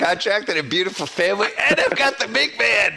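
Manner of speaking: loud, high radio boast; Mike Francesa impression. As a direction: build to a big loud brag. Quoting the Francesa rant.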